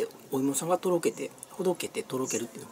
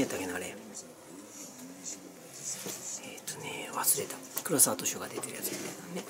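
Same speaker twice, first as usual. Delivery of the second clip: whispering; 8 semitones lower, 12 dB quieter, slower than usual. Real, not acted. Forgetting aside, under breath.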